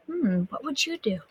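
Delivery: usual speaking voice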